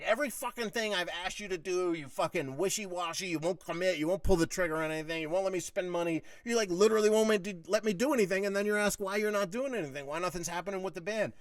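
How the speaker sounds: high-pitched, relentless list of grievances; exasperated, piling-on rant; retold tirade. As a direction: lay out every complaint in a heated re-enactment. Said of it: "I laid out more and more".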